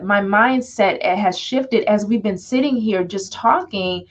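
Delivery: ordinary talk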